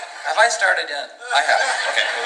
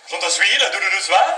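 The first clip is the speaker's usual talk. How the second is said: waiter's French; 7 semitones higher than usual. Retold or imitated. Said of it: "He goes".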